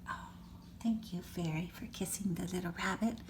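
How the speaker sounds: soft quick unvoiced thanks; hushed grateful aside; whispered thanks. Real, not acted.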